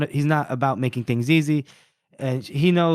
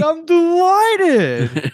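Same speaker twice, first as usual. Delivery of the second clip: drawn-out high, loud gleeful line; slow beaming glee; delighted; 7 dB louder, much slower than usual. Retold or imitated. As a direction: savour every syllable of delight. Arch Lector retold delighted.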